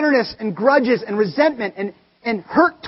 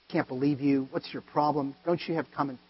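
muttered judgment; 8 semitones lower, 9 dB quieter than usual. Retold or imitated. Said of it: "I wanted to get angry, judgmental".

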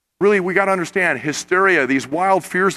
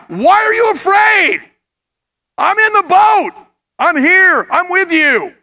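loud, high call of reassurance over the storm; urgent raised voice asking why they fear; Jesus calling out; 9 semitones higher, 4 dB louder than usual. Acted. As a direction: shout it over the wind to frightened disciples.

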